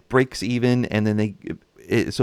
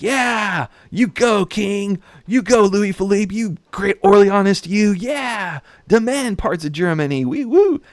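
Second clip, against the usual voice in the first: much higher, louder mock cheering; thrilled cheering; 12 semitones higher, 5 dB louder, slower than usual. French crowd cheering on their king, in a high, loud mock fan voice. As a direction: hype up the king like a gushing fan. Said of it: "the French people are all thrilled".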